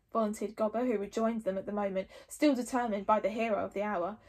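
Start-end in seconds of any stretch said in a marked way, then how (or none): none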